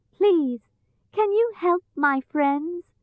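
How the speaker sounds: put-on high, pleading girl's voice; pleading Dorothy. A narrator's reading. Dorothy asks monkeys for help, pleading. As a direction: ask earnestly, pitched high.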